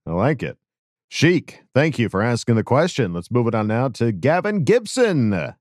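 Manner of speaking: ordinary delivery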